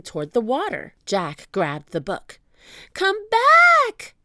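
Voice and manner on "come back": very high, loud call back; urgent high-pitched holler; shouting. A narrator's reading. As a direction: yell high after someone running off. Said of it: "Annie shouted".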